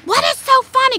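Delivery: high, loud exasperated question; indignant demand. Retold or imitated. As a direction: re-enact confronting the laughing girls. Angry mother confronts laughing girls.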